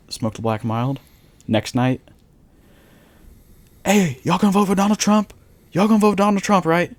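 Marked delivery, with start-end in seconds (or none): 3.8-6.9 high-pitched, loud street pitch; put-on hustler voice, pitched up; imitated street hustler. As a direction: call out like a hustler working passersby.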